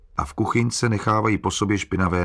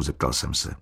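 ordinary delivery, nothing marked

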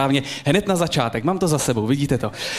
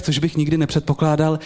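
ordinary talk

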